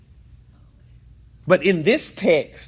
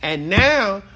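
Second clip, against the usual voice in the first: preaching, raised voice; 3 semitones higher, 5 dB louder than usual. Real, not acted. Teacher preaching emotionally.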